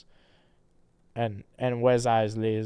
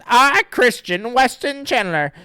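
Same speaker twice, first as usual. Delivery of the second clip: character voice; 10 semitones higher, 10 dB louder than usual. Acted. Reading the dedication in voice.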